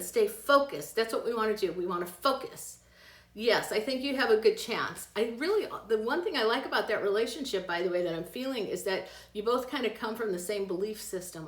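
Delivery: usual speaking voice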